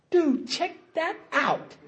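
high, drawn-out, hushed amazement; amazed aside. Retold or imitated. Showing his buddy Kurt the promise in the book, amazed. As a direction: nudge a buddy in slow, high-pitched wonder.

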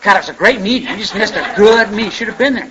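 lightly hammed-up raving; mock enthusiastic. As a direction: play someone talking up what was missed. Acting out member raving about missed meeting.

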